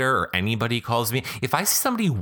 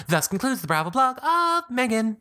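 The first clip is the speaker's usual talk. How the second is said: announcer voice. Acted. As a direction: announce the end with flair. Announcing the segment ending.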